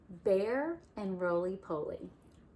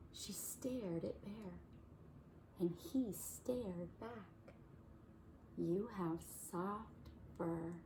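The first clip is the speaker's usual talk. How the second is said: soft, slow tender reading; slow right down and soften; tender hush; 11 dB quieter, slower than usual. A narrator's reading. Narrator softening as Bear meets the baby.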